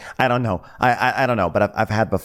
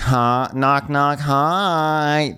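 sing-song, higher knock-knock greeting; chirpy knock knock; 7 semitones higher, much slower than usual. Retold or imitated. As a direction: sing it brightly at the door. Jill checks in on them.

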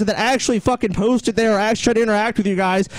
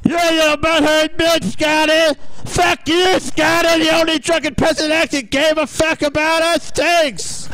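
mocking fan voice; 5 semitones higher, slower than usual. Mocking hostile fans.

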